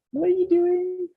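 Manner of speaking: high, bemused question; surprised. Real, not acted. Father to child, high voice.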